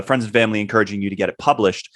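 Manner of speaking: ordinary talk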